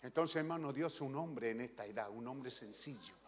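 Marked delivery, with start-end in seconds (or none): none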